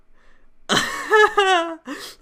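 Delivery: squeaky character laugh; high giggle. Acted.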